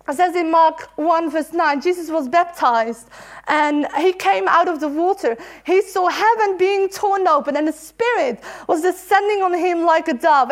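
ordinary talk, nothing marked

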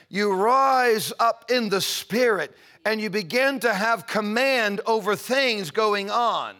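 loud, high, soaring preacher's cry; preaching at full force. Acted. Preacher lifting the congregation into prayer, full force. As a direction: lift the room, stretching the key words slow.

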